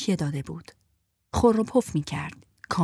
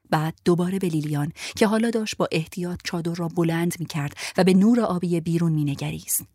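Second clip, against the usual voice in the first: ordinary delivery, nothing marked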